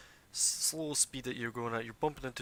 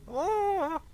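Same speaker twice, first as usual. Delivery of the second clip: high calling voice; 9 dB louder than usual. Acted. GM voicing hidden creature.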